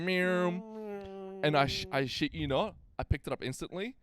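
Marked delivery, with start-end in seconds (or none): 0.0-1.4 higher playful cat meow; mock cat meow. Babysitter meows to get the kid to eat, playful. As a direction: coax like a silly cat. Feeding trick noise.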